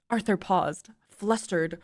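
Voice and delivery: ordinary delivery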